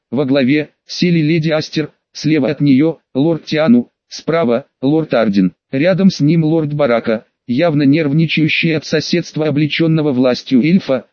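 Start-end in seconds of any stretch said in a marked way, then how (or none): none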